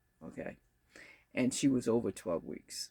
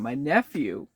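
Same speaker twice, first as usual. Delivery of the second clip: usual speaking voice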